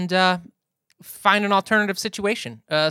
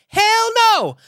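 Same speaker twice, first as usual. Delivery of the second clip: high, loud exclamation; emphatic refusal; 14 semitones higher, 5 dB louder than usual. Acted. Cocky surgeon asked about other patients, loud emphatic refusal. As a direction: belt it out in mock defiance. Emphatic answer to own question.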